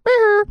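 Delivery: very high, loud squeaky meow; cute taunting meow; cartoon meow. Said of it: "just so he can go".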